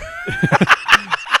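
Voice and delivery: loud high-pitched laugh. Real, not acted. Big laugh at joke.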